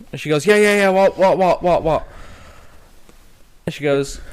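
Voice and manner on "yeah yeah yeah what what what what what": high, loud panicked rush of yeahs and whats; frantic. Retold or imitated. Frantic mum answers the woman who found her lost son. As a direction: grab at the news desperately.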